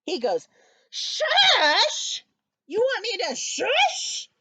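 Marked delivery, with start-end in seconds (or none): 0.9-2.2 loud, high, incredulous SHUSH; indignant shriek rising at the end; angry yell. A narrator's reading. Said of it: "and he's yelling". 2.7-4.3 high-pitched, indignant question; outraged disbelief, voice shooting up; getting very angry. A narrator's reading. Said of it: "He's getting very angry".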